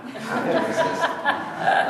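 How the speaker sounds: high, slow words dissolving into a laugh; laughing through it. Real, not acted. Speaker laughing at his own pun joke, laughing. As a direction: giggle the line out.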